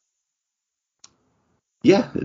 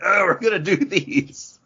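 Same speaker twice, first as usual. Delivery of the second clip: imitating John; 12 semitones higher than usual. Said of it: "John was like".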